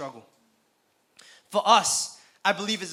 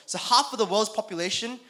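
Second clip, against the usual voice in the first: ordinary delivery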